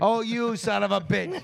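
mock outrage. Host reacting to the guest skipping the show with mock outrage. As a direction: play up being offended.

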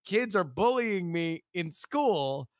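usual speaking voice

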